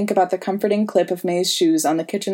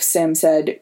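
ordinary delivery, nothing marked

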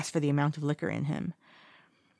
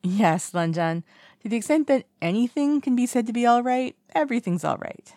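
lightly voiced drunk young man, higher; tipsy and overly bright reassurance; breezy tipsy brush-off; tipsy Wei Ying; 7 semitones higher, 5 dB louder than usual. A narrator's reading.

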